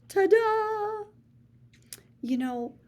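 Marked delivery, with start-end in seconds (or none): 0.1-1.1 much higher, showy flourish; bright game-show reveal; triumphant ta-da. Acted. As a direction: sing it out like a fanfare. Triumphant flourish after catchphrase.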